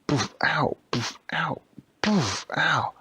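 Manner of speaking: whispered poofs and yelps; poof and ow noises. Acted. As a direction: play the kicks and the ouches. Kicking sounds and yelps.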